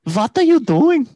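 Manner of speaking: high, loud, slow Italian; mock Italian accent. Trying an Italian accent for the kid, loud and high. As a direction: ham up an Italian parent. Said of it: "an Italian accent".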